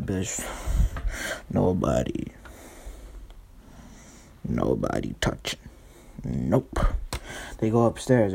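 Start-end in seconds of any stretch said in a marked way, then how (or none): 1.5-6.8 low stoned mumble to himself; guard the stash in a low, dopey voice; stoned guy. Acted. Stoned Pat guarding his stash.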